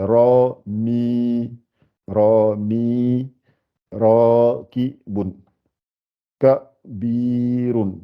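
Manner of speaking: syllable by syllable, holding "ra", "mi" and "bi" long; long vowels held. Shown as a demonstration. Teacher showing how ya lengthens the i, drawn-out syllables. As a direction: stretch the vowels in "ra", "mi", "bi" on a steady note. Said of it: "c'est pour allonger le i qui est là".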